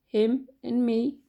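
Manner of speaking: ordinary delivery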